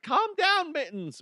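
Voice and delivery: high fussy owner soothing her dog; high-pitched pet owner pleading; mock dog owner. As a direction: coo at the dog like the lady in the park. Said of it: "She's like".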